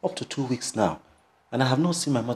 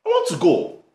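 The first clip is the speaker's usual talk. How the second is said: high, raised protesting voice; heated insistence; 10 semitones higher, 4 dB louder than usual. Acted. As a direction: insist loudly, pitch jumping up. Son insisting in a quarrel.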